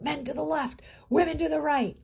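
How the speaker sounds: high, loud order to a crowd; barked command. A narrator's reading. SS man ordering prisoners apart, loud command. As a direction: SS man barking an order at the line. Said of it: "He commanded".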